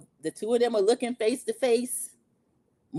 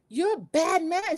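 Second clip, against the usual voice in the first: high, slow, drawn-out imitation; hammed-up hurt reproach; mock wounded accusation; 9 semitones higher, slower than usual. [name] accusing Rob after betrayal, mock wounded.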